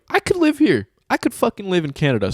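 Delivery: high-pitched, dreamy-eyed enthusiasm; thrilled. Retold or imitated. Retelling own thrilled reaction.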